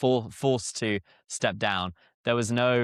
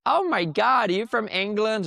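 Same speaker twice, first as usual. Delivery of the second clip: much higher, loud excited squeal; gushing American lady; 11 semitones higher, 4 dB louder than usual. Retold or imitated. Store lady reacting to hearing a British customer, imitated gushing. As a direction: imitate an overexcited American shopper. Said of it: "the lady's like".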